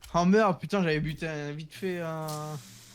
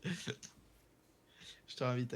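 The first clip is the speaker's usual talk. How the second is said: slow drawn-out; 3 semitones lower, 11 dB quieter, much slower than usual. Real, not acted. Drawn out invite.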